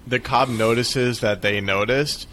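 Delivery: usual speaking voice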